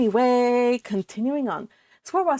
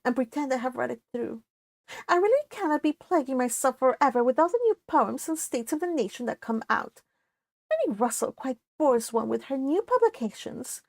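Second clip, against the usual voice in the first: higher, airy disdain, rising to a peak; haughty Elizabeth; 4 semitones higher than usual. Acted. Elizabeth gossiping about Lady Russell to Anne, higher and scornful. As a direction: sneer at Lady Russell like a vain young lady. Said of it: "said Elizabeth".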